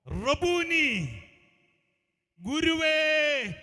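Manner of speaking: high, loud, long-drawn call; cry from within. Retold or imitated. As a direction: cry out to the teacher, stretching each word. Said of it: "ഇവളുടെ അകത്തുനിന്നൊരു വാക്ക് വന്നു". (ഉള്ളിൽ നിന്നുള്ള നീട്ടിയ ഉറക്കെ വിളി)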